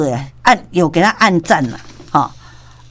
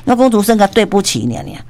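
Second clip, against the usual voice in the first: ordinary talk, nothing marked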